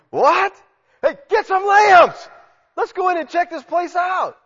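high, loud, slow startled calls; excited high shouting orders; startled jailer. Acted. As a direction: jailer shouting for lamps in shock. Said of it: "he's like".

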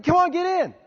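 high, loud coaxing; acted-out pushy invitation; insistent urging. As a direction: urge a reluctant stranger along. Acting out urging a panhandler.

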